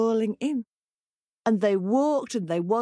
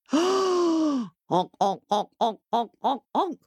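goose honking; 6 semitones higher than usual. A narrator's reading. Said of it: "said Goosey Lucy".

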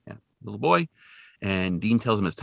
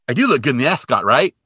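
louder, bragging and pleased with himself; mock vain boast; 3 semitones higher, 9 dB louder than usual. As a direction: show off the ascot loudly. Dean fishing for approval.

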